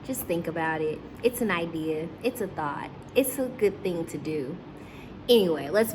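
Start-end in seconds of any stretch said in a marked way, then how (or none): none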